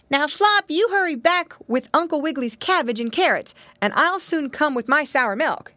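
put-on high-pitched child giving orders; little piggy boy, bossy. A narrator's reading. Piggy boy sends brother back with vegetables, high and busy. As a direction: send him off in an eager little-boy voice.

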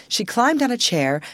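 plain reading voice

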